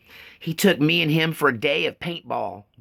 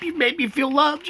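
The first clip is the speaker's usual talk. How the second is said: fighting back tears on the word loved; choked up; 13 semitones higher than usual. Real, not acted. Thanking viewers for letters, choked up and breaking. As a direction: voice breaking high with real tears. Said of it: "it's choking me up".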